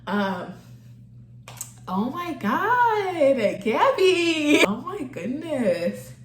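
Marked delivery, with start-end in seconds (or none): 1.9-6.1 real high, drawn-out near-tearful wail; overcome, voice pitched way up; about to cry. Real, not acted. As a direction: stretch it out slowly, fighting back tears. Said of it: "I'm really about to cry".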